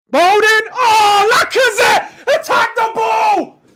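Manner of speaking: high, loud, drawn-out yell at the striker; yelling at the screen. Real, not acted. Fan roaring at Lacazette to attack the ball, real frustrated shout. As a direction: bellow the name, begging him to attack.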